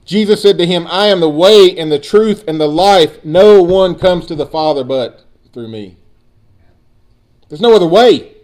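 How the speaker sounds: loud, high preacher cadence; full-force preaching. Acted. Pastor proclaiming Jesus' words to the church, loud. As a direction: proclaim it to the congregation.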